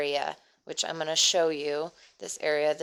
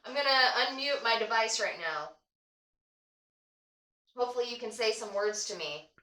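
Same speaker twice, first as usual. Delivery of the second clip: higher, gentle coaxing voice; talking to a ghost; 5 semitones higher than usual. Real, not acted. Addressing the ghost in session.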